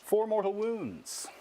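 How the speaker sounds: high, rising thrill at each number; excited counting. Real, not acted. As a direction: count up with growing glee. Counting damage rolls aloud.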